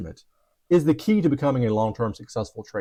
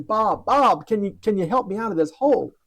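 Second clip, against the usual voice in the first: pitched-up desperate plea for help; desperate call; 6 semitones higher than usual. Retold or imitated. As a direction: cry out to his friend, last hope. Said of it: "out of desperation".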